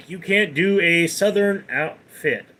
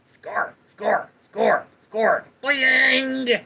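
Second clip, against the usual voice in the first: staccato cartoon chant leaping into a high boing; Beavis; 7 semitones higher, much slower than usual. Streamer performing for chat, excited Beavis impression. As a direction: chant it as a cartoon teen, then shoot up high. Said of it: "Love the Beavis impression".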